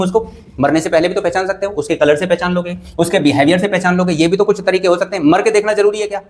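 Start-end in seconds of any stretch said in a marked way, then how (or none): 5.2-6.3 amused question breaking into a laugh; chuckling rhetorical jab; laughing at own joke. Real, not acted. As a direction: tease the class and laugh. Teacher jokes about dangerous advice.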